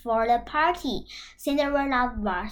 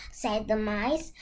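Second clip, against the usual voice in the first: plain reading voice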